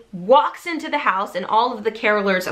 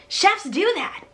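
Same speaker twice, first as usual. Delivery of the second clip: mild mock yell; excited yell; 8 semitones higher, slower than usual. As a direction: boast it gleefully. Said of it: "she yells at her boyfriend".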